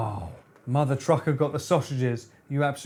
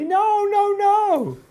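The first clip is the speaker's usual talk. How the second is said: very high squeal of no; shrieking; 5 dB louder, slower than usual. Real, not acted. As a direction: squeal in amused horror. Shrieks at the screen.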